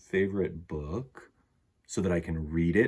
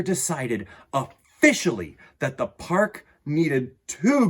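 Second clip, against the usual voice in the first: animated read-aloud with lively swings in pitch; children's story narration; 3 semitones higher, 3 dB louder than usual. A narrator's reading. Reading a picture book about a lion gardener to children, animated storytelling. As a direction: read it to children with playful, varied emphasis.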